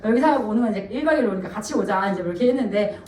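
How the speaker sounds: usual speaking voice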